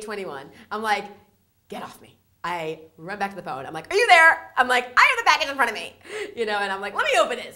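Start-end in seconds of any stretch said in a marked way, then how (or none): 1.7-2.2 hushed disbelief. Retold or imitated. 3.9-7.5 high, loud, eager calls; breathless eager phone talk; excited rush. Retold or imitated. To the interviewer on speakerphone, high excited calls.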